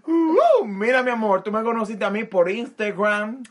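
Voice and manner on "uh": high squeal. Real, not acted. Dramatic lead-in to role play.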